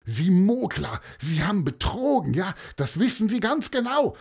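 put-on high-pitched, furious boy; yelled accusation. A narrator's reading. Boy accuses the man of cheating, yelling. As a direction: hurl the accusation angrily at him. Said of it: "brüllte er Herrn Bölker an".

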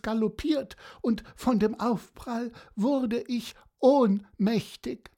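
a hint of a chatty seahorse telling tall tales; storyteller slipping into the seahorse's rambling voice; lightly voiced seahorse. Seahorse rambles to Pieper about its adventure, lightly voiced.

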